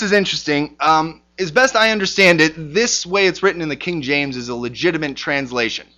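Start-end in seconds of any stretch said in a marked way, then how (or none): none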